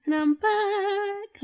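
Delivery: high, slow, sing-song "I'm back"; drawn-out announcement. Real, not acted. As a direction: stretch it out like a grand entrance. Drawn-out triumphant return announcement.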